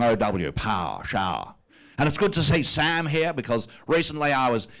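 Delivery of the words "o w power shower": "power" y "shower" con el diptongo OW estirado y saboreado; savoring the OW diphthong. Shown as a demonstration. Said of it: "I like that diphthong".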